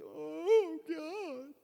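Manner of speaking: high, drawn-out whiny moan; hammed-up pitiful plea; mock whining. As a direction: whine it up to heaven. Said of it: "we whine to God".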